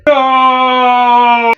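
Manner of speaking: long, loud, high "no" wail; drawn-out theatrical scream of defeat; falling villain's scream. Acted. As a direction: cry out as you plunge to your doom. Villain falls into the pit.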